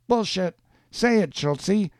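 high-pitched, scornful woman's challenge; angry dare. A narrator's reading. Woman demands man say the insult, angry challenge. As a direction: dare him in a high, hard voice. Jeannie dares him in a quarrel.

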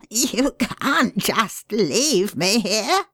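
high, slow, tearful plea; pleading. A narrator's reading. Prisoner begs the pale man not to leave him, pleading. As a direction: beg him not to walk away. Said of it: "he pleaded".

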